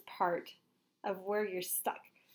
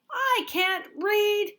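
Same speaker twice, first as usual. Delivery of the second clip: high, loud, very slow complaint; mock whiny kid; 12 semitones higher, 7 dB louder, much slower than usual. Teacher acting a child frustrated with chapter books. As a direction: whine it like a stuck reader. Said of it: "instead of saying".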